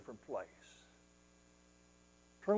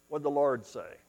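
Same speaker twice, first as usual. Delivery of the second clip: usual speaking voice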